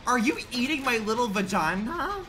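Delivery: very high, loud, stretched-out outrage; high loud indignant cries; shocked squeal. Real, not acted. Toolbox progress gone.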